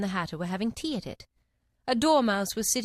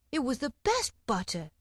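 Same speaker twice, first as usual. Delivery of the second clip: meekly; 4 semitones higher than usual. A narrator's reading. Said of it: "meekly replied".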